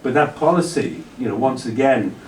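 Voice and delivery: usual speaking voice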